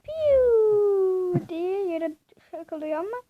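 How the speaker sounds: ordinary talk, nothing marked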